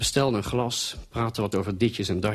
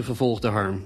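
usual speaking voice

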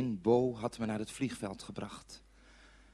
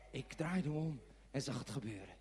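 ordinary delivery

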